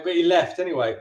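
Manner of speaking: ordinary delivery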